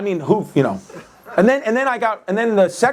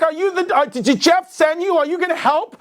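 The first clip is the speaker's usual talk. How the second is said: higher, fussy homeowner voice; mock lady voice; 8 semitones higher than usual. Homeowner asking the therapist for help, higher mock lady voice. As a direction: play the anxious lady.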